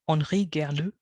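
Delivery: French accent on the name; French pronunciation. Real, not acted. Lecturer slips into French accent for a name. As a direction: say the name the French way, not anglicised. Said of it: "I don't know how to say that with an English accent".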